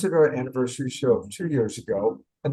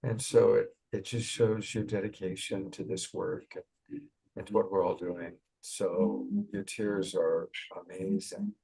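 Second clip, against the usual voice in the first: tender comfort; 4 semitones lower, 7 dB quieter than usual. Real, not acted. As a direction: gently reassure her tears are welcome. Comforting a crying friend.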